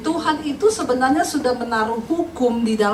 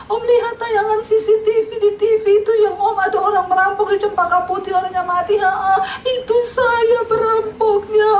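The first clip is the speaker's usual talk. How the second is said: tearful confession; 8 semitones higher than usual. Retold or imitated. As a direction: wail out a guilty confession, hammed up. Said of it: "Nangis dia di rumah omnya".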